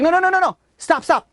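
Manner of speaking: high-pitched, acted frantic begging; panicked plea. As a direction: plead for the torture to stop, pitch leaping up. Tortured man begs them to stop.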